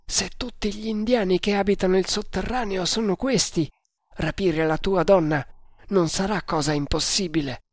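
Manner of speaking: lightly voiced servant, high at first; a hint of a high-voiced servant, reassuring the master; loyal servant figure.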